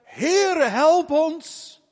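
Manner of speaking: high, loud cry for help from the sinking boat; desperate shout. Acted. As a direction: scream it out, terrified disciples. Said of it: "schreeuwen tot hem". (wanhopig geschreeuw om hulp)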